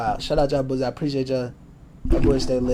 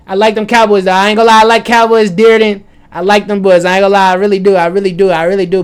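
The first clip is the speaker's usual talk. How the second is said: high, very loud, insistent praise; real fired-up fan outburst; loud gushing; 6 semitones higher, 15 dB louder than usual. Real, not acted. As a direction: gush at full volume about your team. Gushing about the Cowboys.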